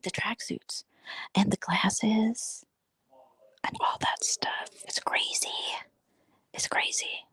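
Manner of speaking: hushed whisper, barely voiced; whispered gossip. Real, not acted. Dishing to viewers about the guys, whispered. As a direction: lean in and whisper it like a secret.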